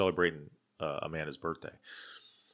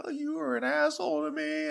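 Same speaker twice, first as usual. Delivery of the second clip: much higher mock weepy whine; drunk crying. Drunk girlfriend crying at him in the car, high mock whine. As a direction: imitate her drunk sobbing. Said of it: "she started crying".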